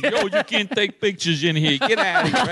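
hammed-up Italian accent, shooing them away. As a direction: protest in a put-on Italian accent. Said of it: "an, an Italian accent".

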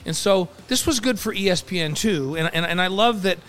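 usual speaking voice

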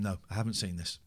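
ordinary delivery

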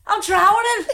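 high, loud, hammed-up Scouse cry; Liverpool accent. Pretending to swim and drown, exaggerated Liverpool accent. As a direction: call for help in mock Scouse. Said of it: "pretending I'm from Liverpool".

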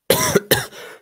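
real chesty cough; coughing fit. Real, not acted. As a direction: cough hard mid-sentence. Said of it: "Sorry, I'm coughing a lot tonight".

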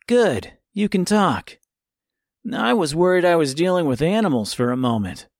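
a cheeky boy mocking the wolf; cocky boy voice. A narrator's reading. Tip teases the wolf.

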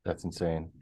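low, soft disbelief; quiet. Real, not acted. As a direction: mutter it in disbelief. Repeats the phrase twice.

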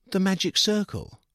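put-on high boyish gushing voice; enthusiastic young man. A narrator's reading. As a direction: gush like a keen kid. Sam voiced, innocent.

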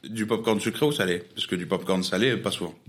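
normal talking voice

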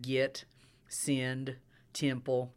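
"git", "send", "temple" with short I for E; Southern I-E substitution. Shown as a demonstration. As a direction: say "send" as sind and "temple" as timple. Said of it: "There's an I E substitution".

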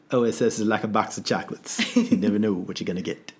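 a hint of Forrest Gump quoting his mama; Forrest Gump impression. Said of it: "Mom always said".

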